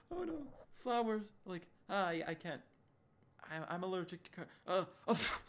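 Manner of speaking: high-pitched, halting, about to sneeze; imitating someone choking back sneezes; mock sneezing fit. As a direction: stammer through a rising fake sneeze. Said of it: "breaking out in sneezing fits".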